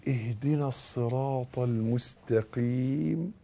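very slow, soft, low chant; Quran recitation. Shown as a demonstration. Teacher showing how to say the verse, slow soft recitation. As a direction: recite softly with long-drawn vowels.

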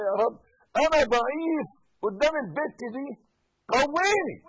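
loud, high, fast plea to God; pleading prayer. Shown as a demonstration. Preacher showing the faithful how to beg God, loudly. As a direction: cry out for strength. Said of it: "قل لربنا".

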